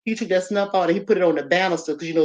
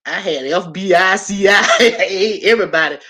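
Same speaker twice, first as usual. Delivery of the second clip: laughing exaggeration; 7 dB louder than usual. Real, not acted. Telling the audience everyone was hunting her, loud laughing. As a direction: laugh as you pile it on. Laughing about being hunted.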